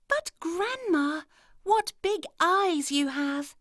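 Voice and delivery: put-on high, slow child voice; wide-eyed little girl. A narrator's reading. Girl to the wolf in bed, high and slow. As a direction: draw it out like a curious girl.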